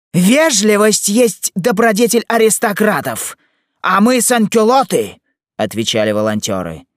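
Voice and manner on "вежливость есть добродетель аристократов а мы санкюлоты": high, loud slogan shouting; slow, very high-pitched loud declaration; defiant retort. Acted. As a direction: shout it back proudly at the rival. Volunteers retort in a quarrel.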